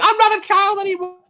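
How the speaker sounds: high-pitched indignant comeback; mock protest. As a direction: protest it like an offended kid. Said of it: "I'm like".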